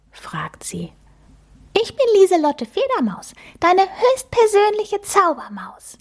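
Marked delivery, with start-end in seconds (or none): 1.7-6.0 much higher, tiny and sweet mouse voice; a cute magic mouse introducing herself; little mouse voice. Acted. The magic mouse introduces herself to Emma, high and squeaky. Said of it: "sagt das hübsche Mäuschen".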